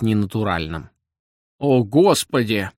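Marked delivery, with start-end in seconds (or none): 1.6-2.7 put-on old man's slow, mumbled lament; mumbling old man. A narrator's reading. Old man laments to the rising sun, slow mumble. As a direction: draw it out like a toothless old man. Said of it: "зашамкал обитатель бревенчатого домика".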